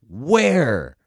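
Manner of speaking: Where hit loud, a held falling Where; hammy stressed Where. Shown as a demonstration. Coach parodying actors, overplayed loud Where. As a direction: lean hard on the single word Where. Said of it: "'cause it's like".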